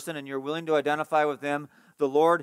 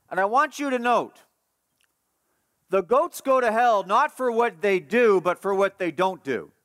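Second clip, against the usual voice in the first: higher-pitched, insistent pulpit delivery; forceful preaching; 6 semitones higher, 4 dB louder than usual. Real, not acted. Preacher warns congregation why goats go to hell, forceful preaching. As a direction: press the point home with raised voice. Preacher drives home his point.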